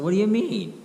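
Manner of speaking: high, startled what do you mean; retold stunned reaction; shocked question. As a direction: jolt up in disbelief. Told friend didn't want him.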